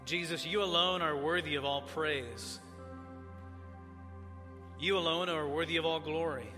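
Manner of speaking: ordinary talk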